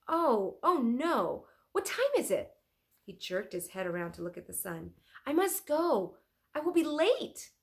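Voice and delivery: startled, remembering he's late; alarmed. Acted. Leonardo suddenly alarmed.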